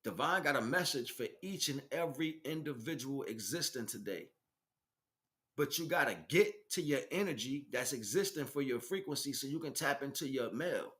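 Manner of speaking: ordinary delivery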